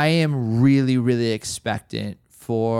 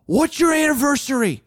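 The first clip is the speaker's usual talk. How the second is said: higher, direct probing voice of the mentor; blunt mentor question; 13 semitones higher than usual. Retold or imitated. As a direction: fire the question at him point-blank. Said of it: "kinda New York ways".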